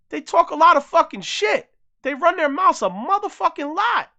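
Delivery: high, loud, heated yelling; loud angry rant. Real, not acted. Venting at foreigners who trash America, loud fury. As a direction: let it rip, really fed up. Ranting about critics.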